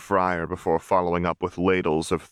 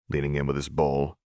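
plain reading voice

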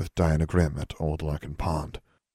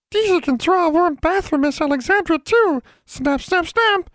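very high, louder squeaky voice; eager servant creature Deasy; 5 dB louder than usual. A narrator's reading. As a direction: pipe up excitedly, competing to serve. Servant creature speaks in story.

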